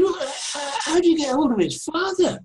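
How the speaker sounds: high-pitched baffled protest; incredulous. Retold or imitated. Author who invented the character, protesting incredulously to caller. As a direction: act out stammering disbelief, pitched high.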